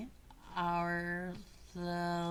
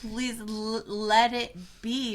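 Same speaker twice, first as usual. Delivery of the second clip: fervent plea; 5 semitones higher, 7 dB louder, slower than usual. Real, not acted. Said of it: "urging emphatically".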